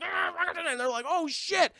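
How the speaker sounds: high choked gasps; mock strangled gasps. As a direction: gasp like you're being strangled. Strangled soldier sound.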